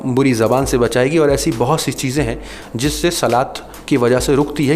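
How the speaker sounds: ordinary delivery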